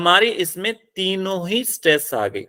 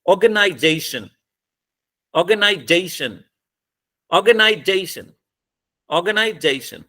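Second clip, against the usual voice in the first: "Organisation" with "जे" hit hardest, falling away on "शन"; syllable-stress demo. Shown as a demonstration. Teacher showing students how to say organisation, stress on "जे". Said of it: "कैसे बोलोगे?".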